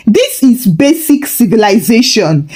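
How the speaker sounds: ordinary delivery, nothing marked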